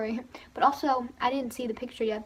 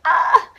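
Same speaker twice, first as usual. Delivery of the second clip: much higher, loud concert scream; fan scream; 8 semitones higher, 15 dB louder than usual. Retold or imitated. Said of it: "literally screaming".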